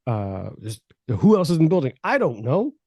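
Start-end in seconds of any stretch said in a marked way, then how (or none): 1.1-2.7 flustered answering. Retold or imitated.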